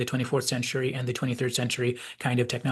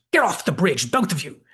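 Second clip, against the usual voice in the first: loud, higher snapping shout; angry shout; 5 semitones higher, 6 dB louder than usual. Retold or imitated. Picard ordering mother and son off the bridge, angry shout. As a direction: throw them both off the bridge at full volume. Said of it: "And he shouts".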